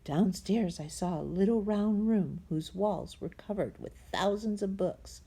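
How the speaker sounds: plain reading voice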